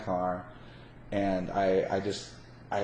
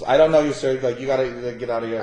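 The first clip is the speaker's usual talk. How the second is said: loud, huffy brush-off of a stranger; mock indignant outburst; 10 dB louder than usual. Scammer loudly shooing off the passerby, mock indignant. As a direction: wave him off like he's a nuisance.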